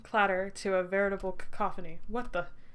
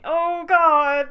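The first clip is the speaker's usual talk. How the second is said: loud "oh God" as things go wrong; dismayed outburst; 8 semitones higher, 11 dB louder than usual. Real, not acted. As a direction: blurt it out in alarm. Panicked reaction in game.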